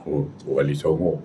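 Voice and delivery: low, hushed mock spirit voice; spirit of a saint. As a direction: play a solemn possessed man, softly. Voicing the supposed saint spirit.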